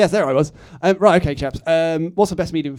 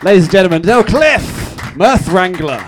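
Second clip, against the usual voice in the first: announcer voice; 2 semitones higher, 10 dB louder than usual. Acted. Game show host introduction.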